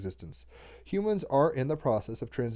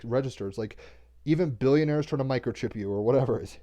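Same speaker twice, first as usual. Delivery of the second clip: usual speaking voice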